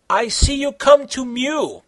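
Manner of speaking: high, odd villain voice; lightly voiced gloating villain; villain laughing. A narrator's reading. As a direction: sneer it as the bad guy king. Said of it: "the bad guy laughed".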